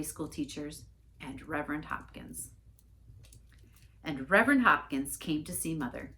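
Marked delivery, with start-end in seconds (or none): none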